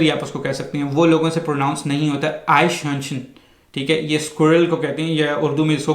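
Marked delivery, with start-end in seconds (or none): 2.5-3.2 आइशहर्नशन said quickly as one word; quick pronunciation. Shown as a demonstration. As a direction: run आइशहर्नशन together in one smooth, fast flow. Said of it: "मैं बड़ी तेज़ी से इसको pronounce कर रहा हूँ".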